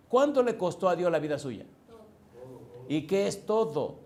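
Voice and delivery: loud, high challenge to the congregation; full-force preaching. Real, not acted. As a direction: fire rhetorical questions at the crowd.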